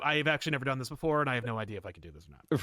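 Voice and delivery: fast, rushed confession; blurting the truth in a rush; hurried admission. Retold or imitated. Huckster priest admitting he never did it, rushed. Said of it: "it goes from one hundred percent to".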